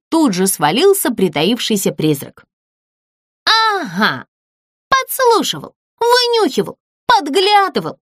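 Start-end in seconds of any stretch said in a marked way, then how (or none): 3.4-8.0 high, angry accusing list. A narrator's reading. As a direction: jab each accusation at the ghost. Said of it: "рассердилась Злюня". (сердито обвиняет, высоким голосом)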